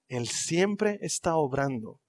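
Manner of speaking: soft, slow, low affirmation; soft refrain. Acted. Preacher quietly affirming God is at work. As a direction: start the refrain almost softly. Repeated sermon refrain building.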